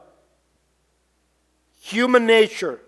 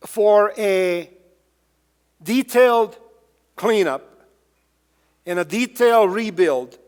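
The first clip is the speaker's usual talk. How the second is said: usual speaking voice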